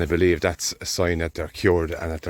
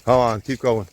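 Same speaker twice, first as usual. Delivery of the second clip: calling out; 5 semitones higher, 5 dB louder than usual. Real, not acted. Urging cattle through gap.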